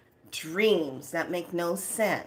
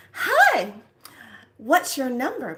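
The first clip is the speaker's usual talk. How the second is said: high, loud mock receptionist greeting; perky door greeter; 7 semitones higher, 7 dB louder than usual. Door lady asking visitors for their number, perky greeting. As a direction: sing out a bright official hello, higher and louder.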